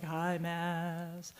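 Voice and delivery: a mock monotone North Carolina accent. Speaker showing the panel how a North Carolina accent sounds, mock monotone North Carolina accent. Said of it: "North Carolina accent's like this, it's very monotone".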